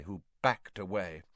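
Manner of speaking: ordinary delivery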